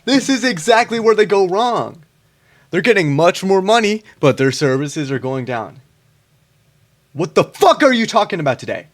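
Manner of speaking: loud, high, exasperated yelling; real angry outburst. Real, not acted. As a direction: blow up at the debater in disbelief. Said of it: "Sorry, I got a little emotional about that".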